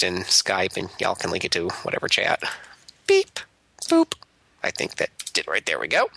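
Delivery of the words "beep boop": robot beeps. Pretend. Imitates a sending noise.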